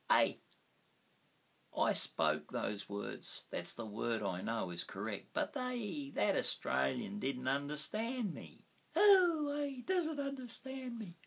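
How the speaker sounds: imitating a frustrated learner, rising to a high squeak; mock the confused student, pitch jumping up; whining student. Teacher imitating a student who wasn't understood, high mock whine. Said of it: "you'll say".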